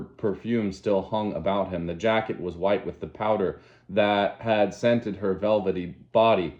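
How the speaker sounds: usual speaking voice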